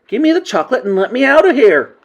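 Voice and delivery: high, loud demanding yell; shouting boy. A narrator's reading. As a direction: shout it like an indignant kid. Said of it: "shouted Bruno, becoming suddenly suspicious".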